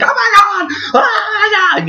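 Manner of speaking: very high, squealed panicky shriek; mock panic. Runner chased by a tiger, mock terrified screaming. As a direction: scream as if fleeing for your life, hammed-up.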